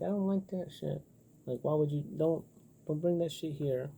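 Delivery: imitating a partner who won't put up with it; annoyed complaint. Telling a partner off, mock annoyed. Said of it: "going like".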